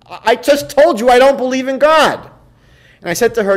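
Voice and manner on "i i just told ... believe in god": mock indignant, high "What do you mean?"; retold angry protest. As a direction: imitate her irritated outburst. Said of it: "she got angry and she said".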